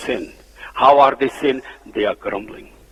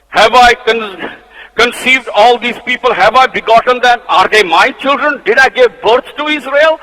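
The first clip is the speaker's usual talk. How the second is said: angry Moses; 7 semitones higher, 6 dB louder than usual. Acted. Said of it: "you can see saying he's in anger".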